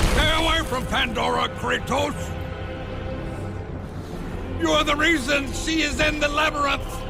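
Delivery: high, forceful shouting; furious outburst. Acted. Smith god orders Kratos away from Pandora, furious. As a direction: shout him off, then accuse bitterly. Father protects Pandora, accuses.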